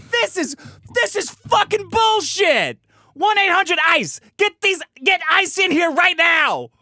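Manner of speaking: high, loud, slow outraged shouting; furious shout. Retold or imitated. Outraged demand at the faker.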